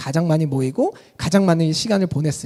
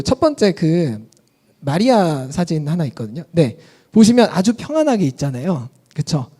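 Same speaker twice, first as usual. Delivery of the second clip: ordinary talk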